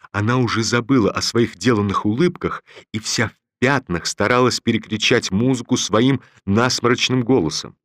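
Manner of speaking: ordinary delivery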